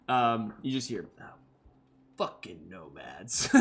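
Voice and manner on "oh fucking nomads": a low, muttered curse, much softer and lower; under the breath. Acted. Targ guard grumbling at the nomads under his breath. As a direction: grumble it sourly to yourself. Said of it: "under the Targ's breath".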